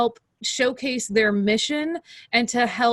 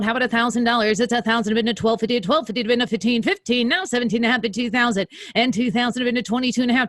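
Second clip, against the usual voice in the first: fast rolling auctioneer chant. Shown as a demonstration. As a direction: rattle off bids like a live auctioneer. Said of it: "do the auction voice".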